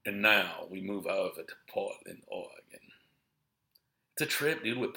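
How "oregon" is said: ordinary delivery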